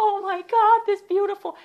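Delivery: breathless, high-pitched, loud swoon; high squealing crush voice; swooning gush. Retold or imitated. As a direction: gush like a starstruck teenager at the door. Swooning over young Bill at door.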